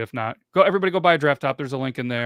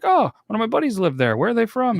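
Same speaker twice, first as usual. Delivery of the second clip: higher, eager retold question; mock eager question; 10 semitones higher, 4 dB louder than usual. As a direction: ask it brightly, curious. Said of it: "I was like".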